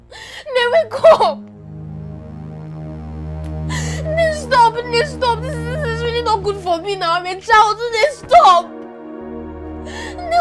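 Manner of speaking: put-on child wailing over his mother; sobbing. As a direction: cry out for her to wake. Child crying over mother's body.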